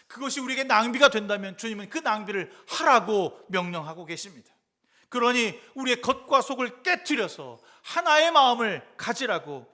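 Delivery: loud, high, driving sermon voice; preaching at full force. Acted. Pastor exhorting the congregation to break their pride, fervent preaching. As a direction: urge the congregation, rising to a peak.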